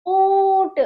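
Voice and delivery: ऊंट loud, with a long buzzing nasal ऊं; loudly sustained nasal vowel in ऊंट; nasalised vowel demo. Shown as a demonstration. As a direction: hold ऊं in ऊंट, resonating in throat and nose. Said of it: "कंपन उत्पन्न हो रहा है और नाक से भी".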